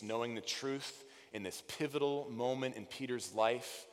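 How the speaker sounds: ordinary delivery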